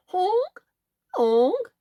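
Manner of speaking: high honking goose call. Acted. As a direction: honk like an old gander.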